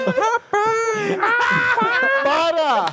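high crying-out TV host shout; Ratinho catchphrase. Pretend. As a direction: wail it like a showman. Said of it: "não quero tá nesse programa".

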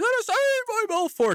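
squeaky falsetto call of the goalie's name; playful high-voiced announcer call. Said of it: "his high voice".

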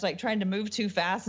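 usual speaking voice

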